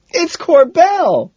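very high, slow, loud shout. A narrator's reading. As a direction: shout it over the music. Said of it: "she shouts".

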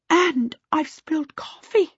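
high-pitched, dismayed wail. A narrator's reading. Missus Baker mopping coffee from her skirt, wailing. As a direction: bemoan a small mishap. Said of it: "Missus Baker wailed".

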